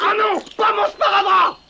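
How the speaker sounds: high, loud wail; shouting. Acted. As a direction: cry out in dismay. Cries out over his bandage.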